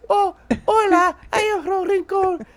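prim Rincon guy. Pretend. Comic showing how a guy from Rincon talks, in a high dainty voice. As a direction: play him prim and proper. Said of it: "the guy from Rincon talk like this".

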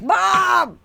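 high, loud mock yell; imitated shout. Imitating a comedian while friends laugh, high mock shout. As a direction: yell for a parent, in a put-on comic voice. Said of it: "He's like".

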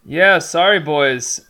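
loud, hammed-up Canadian accent, pitch jumping up on yeah; first try at a Canadian accent, played big. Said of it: "that's my first Canadian accent attempt".

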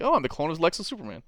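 high, loud cheerful boast; chirpy oblivious clone; mock Connor. Connor introducing himself, high mock-bright.

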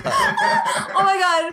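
slow, high, laughing exclamation. Real, not acted. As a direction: crack up through the words. Joking about vanity domain names.

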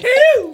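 high loud wailing eeuw; panicked wail. Acted. Sap wails in panic over the birthday. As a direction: let out a panicked cry.

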